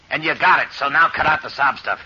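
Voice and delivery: loud, hard and contemptuous; angry snap. Acted. Gangster barks at his scared partner, angry. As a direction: shut down his whining.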